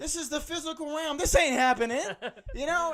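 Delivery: high, loud, incredulous; mock-cosmic disbelief; stunned rant. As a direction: insist it can't be happening. Dazed at the doctor.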